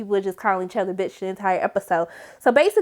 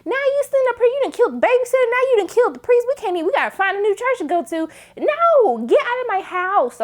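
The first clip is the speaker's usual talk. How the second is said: ranting grandma; 12 semitones higher than usual. Pretend. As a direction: scold him like a furious grandma. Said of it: "That's what she should have said".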